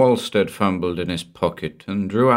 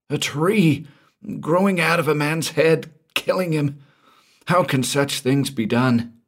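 much higher, horrified and pleading; terrified Walstead; 10 semitones higher than usual. Acted. Frightened man describing a murder, horrified and high. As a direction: gasp it out in disbelief.

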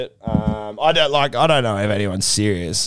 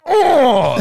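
celebratory cry; 8 dB louder than usual. Retold or imitated. Celebrating a try at TV.

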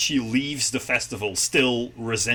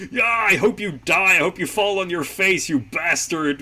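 higher-pitched mock yell; retold yelling; 6 semitones higher, 3 dB louder than usual. Host retells a girl cursing a runner, mock yelling. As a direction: imitate an angry girl yelling, not at full volume. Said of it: "she just starts yelling at him".

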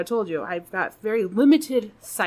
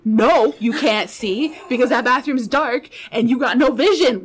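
normal talking voice

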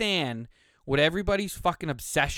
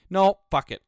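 louder, decisive snap; defiant outburst; 4 semitones higher, 7 dB louder than usual. Real, not acted. Host giving in to his urge to rant, loud burst. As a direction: blurt it out and commit. Decides to rant after all.

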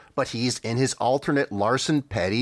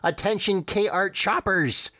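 high, drawn-out announcer call; calling all troops over the microphone; assistant's announcement; 8 semitones higher, slower than usual. Acted. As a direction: announce it slowly in a raised pitch. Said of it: "Art's assistant speaking".

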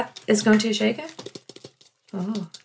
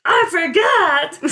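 high, drawn-out outburst; odd exclaiming voice; 6 semitones higher, much slower than usual. Real, not acted. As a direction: stretch it out in a silly higher voice. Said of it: "I have no idea what that voice".

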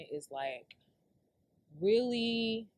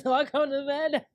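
high, loud, breaking into laughter; laughing through words; 6 semitones higher, 6 dB louder than usual. Real, not acted. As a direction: try to go on while cracking up. Laughing while resuming the story.

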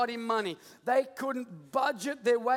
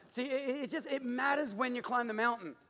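ordinary talk, nothing marked

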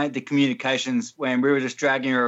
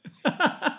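high squealing laugh; 14 semitones higher than usual. Real, not acted. Laughing at own impression.

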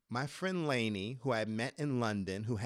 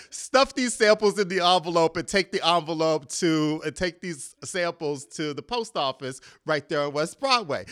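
belted-out errand orders, much higher and louder; loud mock orders; 5 semitones higher, 10 dB louder than usual. Mock boss yelling orders at the assistant, much higher and louder. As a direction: bark the chores out like a busy boss.

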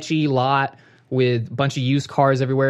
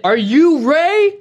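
loud, high, slow retold question; exasperated shout; 13 semitones higher, 7 dB louder, much slower than usual. Caller asking the man behind glass, exasperated mock shout. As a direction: call it out slowly through glass, acting fed up.

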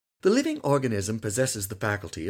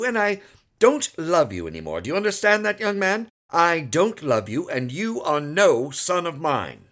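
louder mock-stern lecture; stern scolding father; 5 semitones higher, 6 dB louder than usual. A narrator's reading. Lawyer scolds his son for smoking, mock-stern. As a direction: play angry to teach the boy.

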